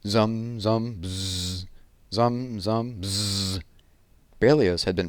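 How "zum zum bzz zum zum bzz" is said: mock hum of a buzzing bass. Reader imitates Berlioz's buzzing double bass, humming.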